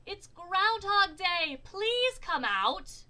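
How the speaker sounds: loud, high, slow shout; whole-town storybook yell; crowd shout. A narrator's reading. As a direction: holler it, stretching every word. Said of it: "said with a shout".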